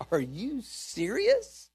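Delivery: high, drawn-out disbelief; retold incredulity. His retold disbelieving reply to her.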